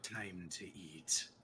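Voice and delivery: low, quiet, slow menacing whisper-like voice; creepy monster voice. Acted. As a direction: breathe it out like a hungry monster. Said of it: "you hear it go".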